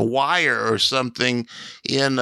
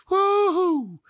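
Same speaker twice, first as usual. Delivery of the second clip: high, drawn-out "woo-hoo"; imitated crowd scream. Mimicking the fan on the crowd-noise cart, high scream. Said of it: "a guy that would scream".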